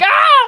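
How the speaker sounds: high, loud yelp of pain; sudden high-pitched cry; acted scream. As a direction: scream out as the hurt man. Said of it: "He's screaming".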